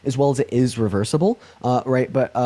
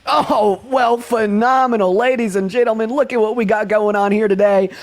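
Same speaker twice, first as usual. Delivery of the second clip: raised-pitch showman patter; hype announcer; 7 semitones higher, slower than usual. Acted. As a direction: play it up for the live audience. Host hypes the next voter.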